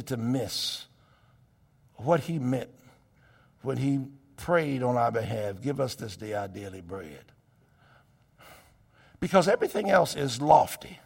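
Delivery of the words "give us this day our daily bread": "Give us this day our daily bread" said low and very soft; soft low prayer. Shown as a demonstration. Preacher quietly recites the prayer, low and soft. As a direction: murmur the prayer close and low. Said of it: "prayer line repeated differently".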